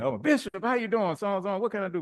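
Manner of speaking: imitated high-pitched, eager welcome; excited greeting. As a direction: greet the bishop in a high, fussing voice. Nurses' greeting on his arrival.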